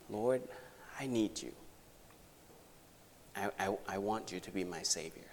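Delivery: ordinary delivery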